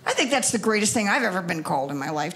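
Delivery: ordinary delivery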